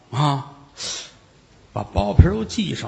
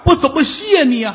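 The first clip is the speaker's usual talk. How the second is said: higher, louder overwhelmed thanks; grateful old man; 10 semitones higher, 5 dB louder than usual. Acted. Moved old man thanks the young man, a touch higher. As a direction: gush with gratitude.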